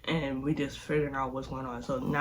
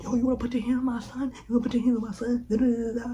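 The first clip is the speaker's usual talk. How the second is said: high, fast mock outrage; mock angry dad; 8 semitones higher, faster than usual. Retelling the dad going off on the boyfriend, mock angry. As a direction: imitate the furious dad going off. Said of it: "he just like".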